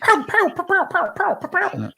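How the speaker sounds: squeaky toy-gun pows; high pow pow sounds. Pretend. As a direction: fire off high little pows.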